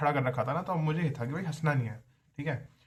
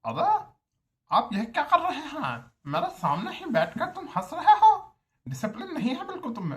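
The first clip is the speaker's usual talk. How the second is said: put-on high, loud scolding in the HOD's accent, laughing through it; mocking the HOD's accent; 13 semitones higher, 5 dB louder than usual. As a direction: ham up the HOD's accent as he tells off a student. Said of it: "उनका accent भी थोड़ा ऐसा है". (HOD के लहजे की ऊँची, ज़ोरदार डाँट की नकल)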